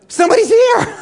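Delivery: very high, loud sudden realization; light up: someone else is here; excited discovery. Acted. Castaway spotting writing in the sand, very high excited.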